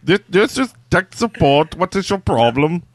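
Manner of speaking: lightly put-on Indian tech-support accent. Second try at the sponsor's line, mock Indian accent. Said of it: "I don't know what accent that was, but it was Indian".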